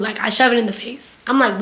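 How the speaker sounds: ordinary talk, nothing marked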